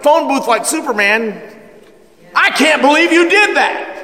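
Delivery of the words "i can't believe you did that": high, mocking devil voice. The devil taunting the sinner, in a high mocking voice. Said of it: "is what the devil says".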